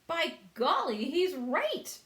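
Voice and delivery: high, loud exclamation; excited cry. A narrator's reading. Centipede crying out that the earthworm is right. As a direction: cry it out in surprise. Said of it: "cried the centipede".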